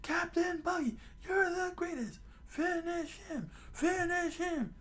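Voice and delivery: squeaky high falsetto cheering; high-pitched minion chant; cheering pirate crew. Acted. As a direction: cheer your captain on in a tiny falsetto. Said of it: "the crew started dancing and jumping for joy".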